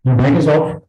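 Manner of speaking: loud calling out. Real, not acted. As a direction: call it out loud so they hear. Repeated call, mic still off.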